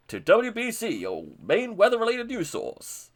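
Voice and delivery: a hint of a newsreader; mock news anchor. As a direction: ham up a weather channel sign-on. Mock weather channel intro.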